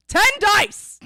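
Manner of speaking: high, loud gleeful yell; ecstatic shout. Real, not acted. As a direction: cheer it out. Excited dice count.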